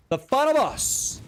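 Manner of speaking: high, drawn-out hyped announcement; shouting. Real, not acted. Streamer hyping the tier seventeen boss to viewers, shouting. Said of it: "I don't know why I'm shouting at you".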